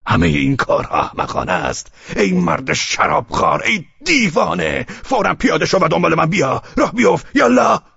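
high, forceful yelling; angry shout. A narrator's reading. Velchaninov ordering the drunkard out of the carriage, shouting. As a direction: order him out, building to a shout. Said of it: "شدیدتر فریاد کشید".

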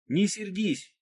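put-on high shouted girl's call; shouting. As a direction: call out from far up the slope. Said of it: "крикнула она".

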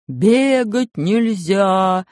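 very slow, drawn-out yell; shouted prohibition. Acted. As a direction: drag out the shout. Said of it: "вдруг ожил и закричал".

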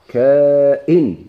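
"كائن" as long kaa, then hamza and -in; "كائن" drawn out, syllable by syllable; variant pronunciation. Shown as a demonstration. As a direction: stretch "كائن" slowly and loudly, kaa-'in. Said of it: "كاين لغة".

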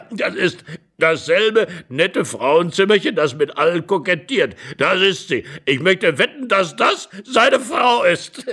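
laughing delight. Acted. Old man betting it is the intruder's wife, laughing. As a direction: share the guess, bursting with mirth.

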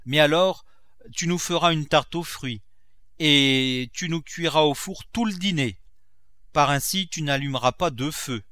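lightly voiced miser Grandet. Miser telling his cook to use one fire, mildly insistent. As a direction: lay down thrifty conditions.

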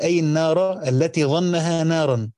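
ordinary talk

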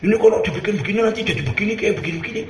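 rushed, pestering argument; fast pestering plea. Retold or imitated. Petitioner badgering the kiai, rushed pleading. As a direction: rattle it off to wear him down.

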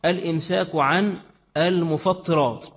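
slow, deliberate "المفطرات" with a doubled ط; teacher's careful, drawn-out "المفطرات", mufattirat; emphasized correct pronunciation. Shown as a demonstration. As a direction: stretch out "المفطرات", leaning on the shadda. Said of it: "شدتها عشان تنطقها صح".

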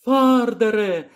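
second high, drawn-out call. Acted. Lyra hails the old man again from the basket, high drawn-out call. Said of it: "гукнула Ліра".

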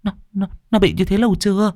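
high, hurried stammering question; trembling fright. A narrator's reading. Shaken neighbour asks how long, frightened stammer. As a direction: stammer it out, alarmed. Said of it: "thì run rẩy".

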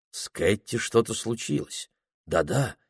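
usual speaking voice

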